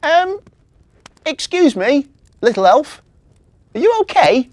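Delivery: put-on high, loud child's voice; calling out. A narrator's reading. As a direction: call from a window to someone outside. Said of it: "called out to Marzy".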